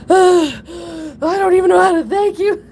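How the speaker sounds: high, loud laughing-crying gush of thanks. Retold or imitated. Retelling thanking the stranger who caught her dog, laugh-crying.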